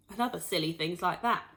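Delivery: ordinary delivery, nothing marked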